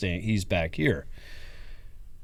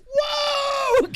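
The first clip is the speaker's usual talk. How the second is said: high whooping yell; excited shout. Retold or imitated. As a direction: whoop into the mic. Retold celebration, mic grabbed.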